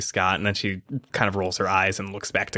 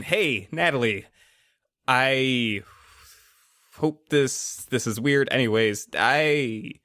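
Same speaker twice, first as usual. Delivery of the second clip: halting and awkward, clearing the throat; lightly voiced nervous [name], slightly higher; awkward, stammering boy; 3 semitones higher, slower than usual. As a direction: stumble through a greeting in pieces.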